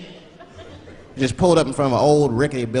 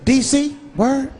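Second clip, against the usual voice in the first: high-pitched chatty reaction; limousine driver; 9 semitones higher, much slower than usual. Acted. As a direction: play the driver echoing with interest.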